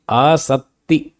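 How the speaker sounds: आसक्ति very slowly, long first vowel, held double t, no k; doubled t. Shown as a demonstration. Teacher drilling the term, slow doubled t. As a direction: stretch आसक्ति into syllables, holding the t. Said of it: "तो double".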